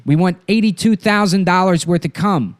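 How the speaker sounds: hammed-up ransom demand in an actor's voice; Buscemi. Kidnapper demanding a ransom, Buscemi impression. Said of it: "Why is he so fucking hard to do?".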